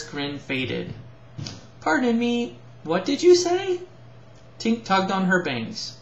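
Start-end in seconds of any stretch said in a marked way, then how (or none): 1.8-3.8 much higher and louder, puzzled; puzzled Prilla. Acted. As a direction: ask again, genuinely confused. Prilla didn't hear.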